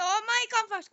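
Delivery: loud, high urgent call. Real, not acted. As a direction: shout for them to hurry. Urging teammate.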